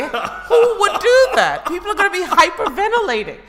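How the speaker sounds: high laughing disbelief. Real, not acted. Laughing incredulity.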